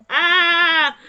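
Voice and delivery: loud high squeal of delight; high joyful shriek. Real, not acted. Player watching the romance scene, high delighted shriek. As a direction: let out a thrilled shriek.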